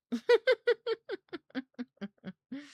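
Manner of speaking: high, loud run of laughter; long giggle. Real, not acted. Laughs at how well it worked.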